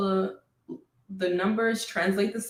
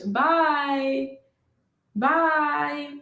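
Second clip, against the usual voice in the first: African accent bye; 5 semitones higher than usual. Shown as a demonstration. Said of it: "bye with the African accent".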